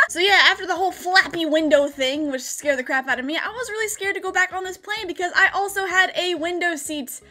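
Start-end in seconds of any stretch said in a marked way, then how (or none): none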